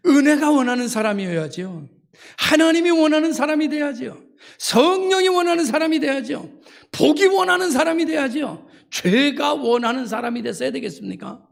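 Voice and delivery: high, insistent preacher's exhortation; fervent preaching. Real, not acted. Preacher urging the congregation on whom to be wanted by, high fervent exhortation.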